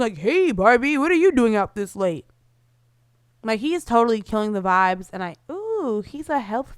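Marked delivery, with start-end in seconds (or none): 0.2-2.3 nosy dad. Retold or imitated. Dad butting into conversation.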